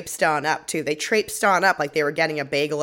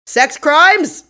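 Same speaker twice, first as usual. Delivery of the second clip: high, loud shouted call; shouted announcement; 10 semitones higher, 9 dB louder than usual. Retold or imitated. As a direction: shout it into the rainy night. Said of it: "someone shouts into the ether".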